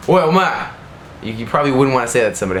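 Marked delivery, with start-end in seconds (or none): 0.1-0.7 higher-pitched demo of calling someone; rude call-out. Shown as a demonstration. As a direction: call out "Oi, omae" brusquely, as an example. Demonstrates rudely addressing someone with omae.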